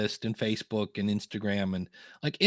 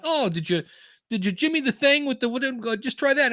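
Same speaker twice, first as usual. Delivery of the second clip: bad New York accent, much higher; made-up New York accent; 11 semitones higher than usual. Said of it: "horrible, weird accent I just made up".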